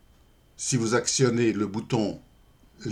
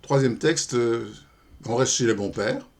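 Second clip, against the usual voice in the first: ordinary talk, nothing marked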